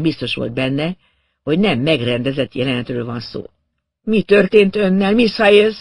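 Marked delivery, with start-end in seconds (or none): 4.0-5.8 raised, loud, urgent concern; high and loud with shock; alarmed question. A narrator's reading. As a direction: ask the dazed woman in alarm. Alarmed man questions dazed woman.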